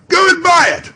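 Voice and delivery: high, loud command down to the street; delighted shouted order; eager shout. Acted. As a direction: send him running. Shouting to the boy.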